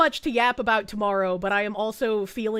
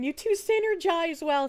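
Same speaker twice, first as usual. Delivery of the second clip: usual speaking voice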